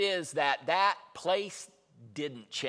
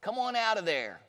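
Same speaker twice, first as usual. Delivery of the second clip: put-on calling the baby out; 9 semitones higher, 7 dB louder than usual. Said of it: "I couldn't just say".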